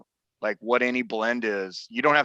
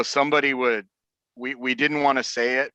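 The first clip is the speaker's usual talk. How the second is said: usual speaking voice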